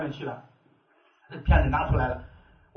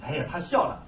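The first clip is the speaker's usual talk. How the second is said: ordinary talk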